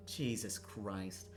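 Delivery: ordinary delivery, nothing marked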